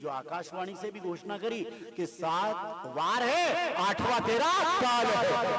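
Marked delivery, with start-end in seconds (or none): 2.1-5.6 loud, solemn voice from the sky; loud oracle declaring fate; booming proclamation. Acted. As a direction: announce doom like a heavenly voice. Divine voice proclaims doom.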